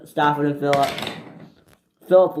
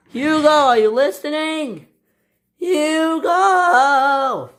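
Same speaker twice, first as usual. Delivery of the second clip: calling out; 12 semitones higher, 5 dB louder, much slower than usual. A narrator's reading. Calling an engine far away.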